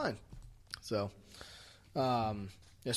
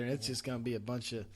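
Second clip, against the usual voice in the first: usual speaking voice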